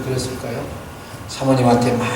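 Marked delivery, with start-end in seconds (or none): none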